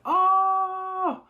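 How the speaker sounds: high, loud startled cry; a viewer caught off guard by a new arrival; shocked gasp. Real, not acted. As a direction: jolt at the sudden entrance. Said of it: "I'm like".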